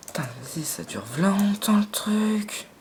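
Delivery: slow, whispered frustration; whispered complaint. Real, not acted. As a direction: mutter it under your breath, slowly. Impatient gamer waiting to regenerate.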